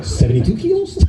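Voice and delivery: high, laughing question; laughing unsure answer. Retold or imitated. New student answers the master, laughing.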